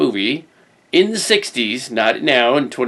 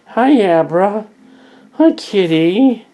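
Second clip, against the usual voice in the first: high, loud sing-song greeting to a cat; drawn-out, high pet voice; pet talk; 7 semitones higher, 5 dB louder, slower than usual. Real, not acted. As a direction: coo high at the kitty.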